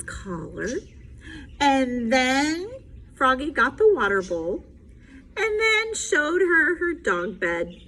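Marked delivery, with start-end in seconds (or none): none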